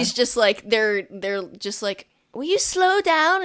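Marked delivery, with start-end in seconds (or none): 2.3-3.4 much higher, pleading complaint; whiny student. Acted. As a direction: call out like a winded kid lagging behind. Students complaining to hurrying prefect.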